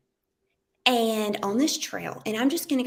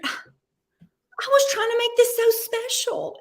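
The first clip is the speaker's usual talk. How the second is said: upset husband; 13 semitones higher than usual. Retold or imitated. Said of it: "he said".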